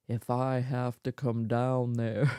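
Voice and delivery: low, slow booming dad warning; stern dad threat. Retold or imitated. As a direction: growl it like a big dad. Dad's threat from down hall.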